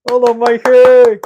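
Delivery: loud shout across the room; calling out. Real, not acted. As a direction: call out to him, loud. Calling out to player.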